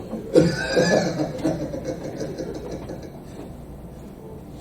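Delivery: high breathy burst of laughter; real laugh. Real, not acted. Laughs after own joke.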